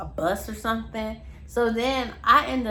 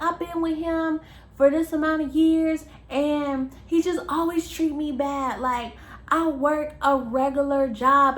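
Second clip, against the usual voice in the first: mock whiny friend; 4 semitones higher, 3 dB louder than usual. Friend quoted complaining.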